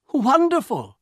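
put-on very high, loud young man's delight; delighted exclamation. A narrator's reading. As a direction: burst out in high excitement. Herbert delighted by three wishes.

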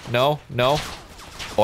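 normal talking voice

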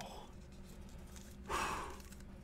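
long breathy sigh of relief. Real, not acted. Relieved the feared card wasn't pulled, long sigh.

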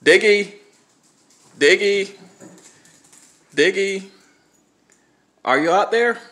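high, loud call into the yard; calling from far away. Real, not acted. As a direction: call out to a lost dog. Calling the dog from outside.

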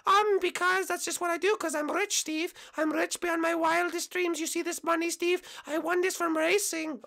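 high falsetto bragging; held falsetto, showing off; boastful cyclist contestant. Acted. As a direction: brag in a put-on girl voice.

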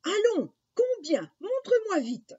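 very high, loud urging; impatient mother. Acted. Mother demands to see the money, high and impatient. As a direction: press eagerly, pitched right up. Mother presses him to show.

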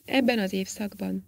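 ordinary delivery, nothing marked

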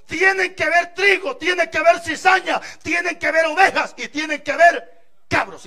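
más alto y más fuerte, martillando cada frase; predicación con fuerza. Acted. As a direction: predica golpeando cada "tienen que ver". (el predicador exhorta a la congregación a toda voz)

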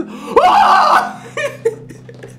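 shrieking laugh. Real, not acted. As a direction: let the laugh break into a shriek. Laughing at howling wolf.

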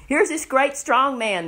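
loud, high mock announcement. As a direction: show him off with jeering scorn. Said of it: "led him around and mocked him".

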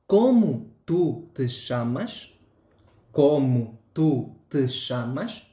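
"¿Cómo tú te llamas?" slow, with a rising ending; slow example question. Shown as a demonstration. Teacher asking a student his name, slowly, with a rising ending. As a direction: ask it slowly, like to a learner.

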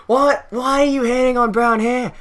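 high-pitched fake Australian protest; pseudo-Australian accent. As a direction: challenge the room in a put-on Australian accent. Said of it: "a pseudo-Australian accent this entire time".

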